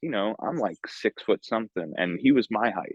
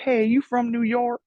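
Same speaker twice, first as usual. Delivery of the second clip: put-on high-pitched older lady's eager question; imitated older Michigan woman; 13 semitones higher, 3 dB louder than usual. Two women behind him in line, imitated high and curious. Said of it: "two women behind me were from Michigan".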